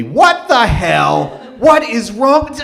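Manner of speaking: loud, slow, disbelieving what the hell; exploding outrage. Retold or imitated. Imagined retort to the rude diner, a slow loud outburst. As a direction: let the politeness crack into a loud outburst. Imagined polite reply bursts out.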